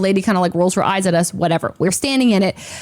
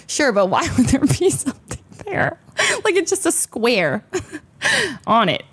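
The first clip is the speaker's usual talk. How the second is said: laughing through it; 3 semitones higher than usual. Real, not acted. Laughing in disbelief about the scan, telling viewers. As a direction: giggle through the words in disbelief.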